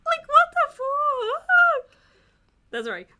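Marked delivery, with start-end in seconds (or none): 0.1-2.0 high, drawn-out disbelieving shriek; outraged shriek. Real, not acted. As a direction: screech it in disbelief. Outraged at the story.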